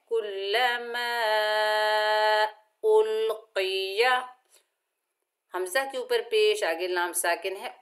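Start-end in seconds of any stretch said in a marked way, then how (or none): 0.1-4.3 कुल्लमा with a long a, slow; stretched madd. Shown as a demonstration. Teacher demonstrating madd munfasil slowly. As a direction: draw out the madd. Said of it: "तीन अलिफ की मिकदार खींच कर".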